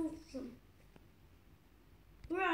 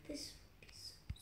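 soft unvoiced whisper to himself; 15 dB quieter than usual. Real, not acted. Player mutters to himself mid-game, whispered. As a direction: breathe it out barely aloud.

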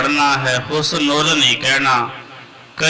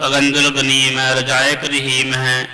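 ਅਗੰਜੁਲ ਗਨੀਮ ਹੈ rattled off fast, words slurred; wrong way, rushed. Shown as a demonstration. Teacher demonstrating a rushed, careless reading not to copy. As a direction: gabble the line carelessly. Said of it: "ਇਸ ਤਰ੍ਹਾਂ ਨਹੀਂ ਪੜ੍ਹਨਾ।".

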